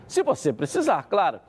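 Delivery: upbeat pitchy sales read; hard sell ad. Acted. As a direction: sell it like a radio spot. Host reads live sponsor ad. (locução de anúncio animada)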